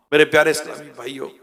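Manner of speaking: ordinary talk, nothing marked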